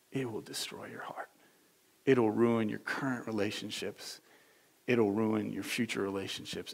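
low, soft, measured plea; God's loving voice. Acted. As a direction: warn gently, with grave care. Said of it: "or do you hear God this way?".

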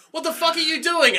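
high-pitched, incredulous demand; mock exasperation. Workers in another part of the mill, high mock exasperation. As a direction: call it out high, hammed-up disbelief.

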